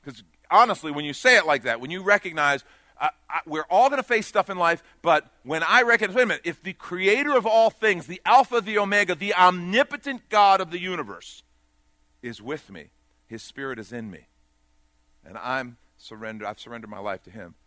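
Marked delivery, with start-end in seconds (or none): 0.0-11.4 loud, rising preacher's call; full-force preaching. Acted. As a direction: drive it home to the congregation, louder.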